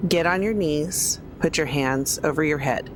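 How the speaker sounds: ordinary talk, nothing marked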